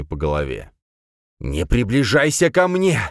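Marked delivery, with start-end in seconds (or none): 1.4-3.0 higher, louder, slow panicked plea. A narrator's reading. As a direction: back away in panic. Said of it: "с паникой в голосе отпрянул некромант".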